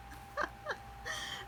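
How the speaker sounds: high, soft laugh; a light giggle escaping quietly. Real, not acted. Tarot reader amused by her own card draw, laughing. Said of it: "I'm laughing about this".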